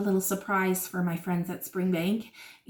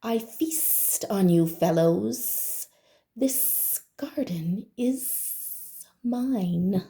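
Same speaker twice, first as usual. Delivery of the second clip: very slow, softer sinister snake drawl; slow menacing snake; 3 dB quieter, much slower than usual. Acted. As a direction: draw it out like a snake sizing up prey. Snake threatens the others.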